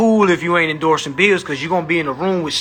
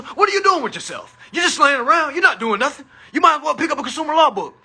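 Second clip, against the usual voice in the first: high, pushy TV ad voice, a bit fast at the end; commercial announcer parody; 8 semitones higher than usual. Retold or imitated. Parodying the old commercial for his viewers, in a high ad voice. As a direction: nag like an old public service ad. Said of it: "y'all remember that commercial?".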